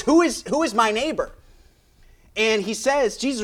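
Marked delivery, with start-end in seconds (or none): 0.0-1.3 higher, louder mocking challenge; sarcastic. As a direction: throw the question back with a sneer. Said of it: "almost in a, uh, maybe a sarcastic kind of tone".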